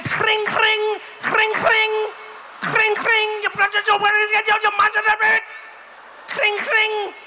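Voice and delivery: high, loud, furious "gring, gring"; angry bell. Acted. Dutch rider warning pedestrian, angry bell and gibberish. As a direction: ring and rant like an enraged bicycle bell. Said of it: "I've never heard a bell sound angry".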